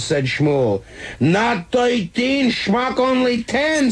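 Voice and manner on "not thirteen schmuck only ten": high, slow, drawn-out scolding; emphatic correction. A narrator's reading. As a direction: spell it out for the dummy. Yankel scolds slowly.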